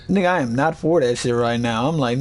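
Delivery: ordinary talk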